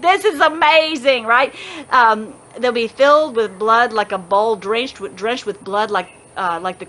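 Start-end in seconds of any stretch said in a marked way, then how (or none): 0.0-1.2 higher, excited mock exclaim. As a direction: gush over it like a thrilled believer. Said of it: "We're like".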